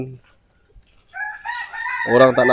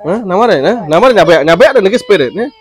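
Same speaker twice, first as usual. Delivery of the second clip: higher, louder sing-song coaxing voice; coaxing a toddler; 7 semitones higher, 5 dB louder than usual. Acted. Parent coaxing a crying child to repeat words, high sing-song coaxing. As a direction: coax the little one along, voice lifted.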